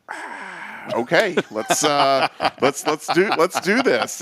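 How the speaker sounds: high, hesitant mock pep talk; retold nervous rallying; flustered stand-in coach. Suddenly left to coach the team.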